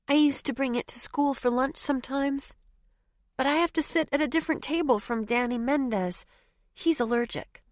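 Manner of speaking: put-on high, chatty child voice; little girl voice. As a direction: play a small girl explaining. Child character explains lunch.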